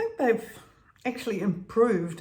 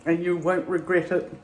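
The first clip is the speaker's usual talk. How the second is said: normal talking voice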